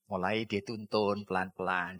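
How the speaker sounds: usual speaking voice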